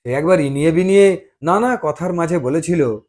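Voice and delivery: ordinary delivery, nothing marked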